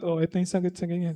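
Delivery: quick, soft whisper to a classmate; whispered answer. Retold or imitated. Shy student passing the answer to a classmate, whispering fast. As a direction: slip the answer quickly under your breath. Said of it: "binubulong ko lang sa katabi ko".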